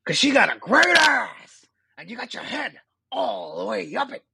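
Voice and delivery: high shouting Pacino rant; Al Pacino in Heat. Pretend. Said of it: "I have a decent Pacino in Heat".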